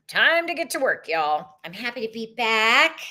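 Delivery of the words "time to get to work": lifted, higher "Time to get to work"; higher excited repeat. Shown as a demonstration. Rallying viewers, higher and brighter on "Time".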